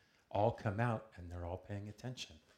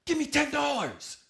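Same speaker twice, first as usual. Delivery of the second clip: full-force high-pitched shout; screaming and yelling; 14 dB louder than usual. Acted. Thief two feet away demanding cash, screaming. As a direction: scream the demand at the top of your lungs. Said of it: "he's screaming and yelling".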